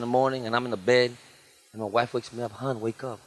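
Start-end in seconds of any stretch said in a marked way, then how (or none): 2.5-3.2 sleepy whisper. Retold or imitated. Wife waking him at night.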